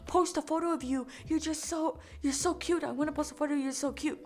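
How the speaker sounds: high, wheedling imitation of a man; pleading pushy man. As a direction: imitate a pest begging for a photo. Voices the pestering man.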